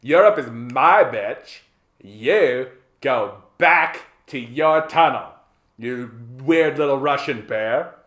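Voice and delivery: loud, slow, hammed-up territorial threat; scornful mock dismissal; possessive United States. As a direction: punch each phrase like a jealous bully. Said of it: "the United States just like".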